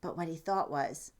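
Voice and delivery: usual speaking voice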